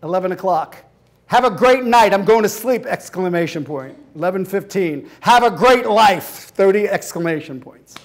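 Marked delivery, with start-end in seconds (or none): 1.3-2.9 loud clipped sign-off; huffy. Retold or imitated. Boyfriend sulking by text, loud huff. 5.2-6.5 slow, loud parting shot; bitter. Retold or imitated. Boyfriend's dramatic final text, slow loud bitterness.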